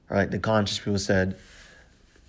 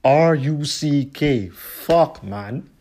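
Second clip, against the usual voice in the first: louder, spelling it out slowly, annoyed; mock upset; 4 semitones higher, 6 dB louder, slower than usual. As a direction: imitate an irritated rapper correcting his name. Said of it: "[name] getting upset".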